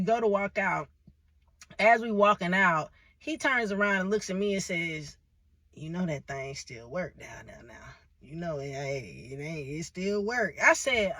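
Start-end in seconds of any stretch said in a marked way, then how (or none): 5.7-10.6 put-on low, slow, quiet old-man mumble, a touch sly; lightly voiced old man. Old man's creepy remark.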